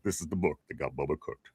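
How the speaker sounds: low, softened deep announcer imitation; James Earl Jones. Host replays the whole commercial line, low and softly intoned. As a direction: intone the old ad in a deep, quiet voice. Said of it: "looks like it was, uh, James Earl Jones".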